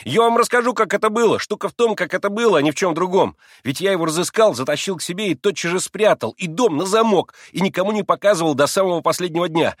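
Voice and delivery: high, loud, excited explaining; eager boasting. A narrator's reading. Boy showing off the trained dog, eager and excited. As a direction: tell it all proudly and urgently.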